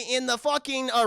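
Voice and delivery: ordinary talk, nothing marked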